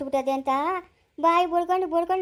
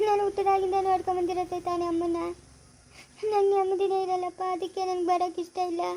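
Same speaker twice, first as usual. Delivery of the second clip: softer, crying through the words; tearful; 4 dB quieter than usual. Acted. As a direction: speak while holding back tears. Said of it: "ಅಳ್ಬೇಡಮ್ಮ".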